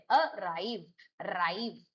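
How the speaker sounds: Arrive with weight on राइव, then राइव alone; stress on second syllable. Shown as a demonstration. Teacher drilling verb stress, stress on राइव in arrive. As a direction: detach अ and lean on राइव. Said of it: "राइव पे stress है".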